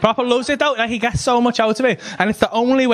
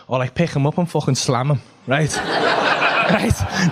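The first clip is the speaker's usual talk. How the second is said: usual speaking voice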